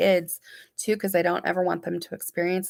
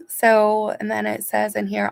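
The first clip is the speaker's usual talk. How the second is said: normal talking voice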